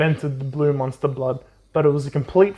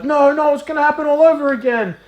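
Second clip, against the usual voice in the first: much higher, fast mock panic; panicked [name]; 11 semitones higher, 3 dB louder than usual. [name] panicking as Conan takes the candy, high mock panic. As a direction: squeal it out like a kid watching disaster repeat.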